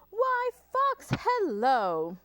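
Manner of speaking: high, loud, singsong greeting; breezy mouse. Acted. Mouse greets Fox beside Gruffalo, breezy. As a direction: greet brightly with a drawn-out hello.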